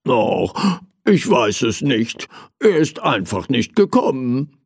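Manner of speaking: ordinary delivery, nothing marked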